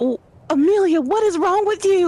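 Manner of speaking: high, loud, slowed shocked scolding; high-pitched startled scolding; startled outburst. Acted. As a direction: yelp at the friend who spooked her. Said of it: "startled and be like".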